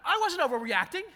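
high, loud indignant protest; defensive outburst. Retold or imitated. Inner voice snapping back at a staff friend, indignant and loud. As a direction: blurt the denial hotly. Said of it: "everything inside of me went".

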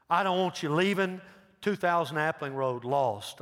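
insistent pulpit urging, slowing at the end; urgent plea. Real, not acted. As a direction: plead hard with the congregation. Urgent altar-call plea.

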